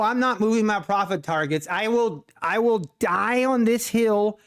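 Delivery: ordinary talk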